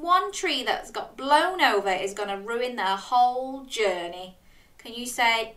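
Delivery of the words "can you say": ordinary delivery, nothing marked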